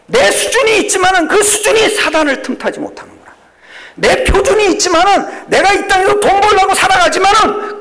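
high, loud preaching cry; preaching at full force. Acted. Pastor to congregation, crying out in full preaching force. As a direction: lift the congregation with a ringing cry. Preacher shouting his climax.

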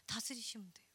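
unvoiced hush on 다스리시면 돼요; whisper. Real, not acted. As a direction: breathe 다스리시면 돼요 like a secret. Softly repeated encouragement.

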